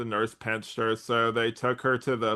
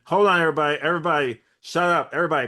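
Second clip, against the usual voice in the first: louder, higher and fast, hushing the room; excited shouting; 5 semitones higher, 7 dB louder, faster than usual. Real, not acted. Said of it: "what my notes say in all caps".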